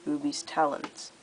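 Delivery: ordinary delivery, nothing marked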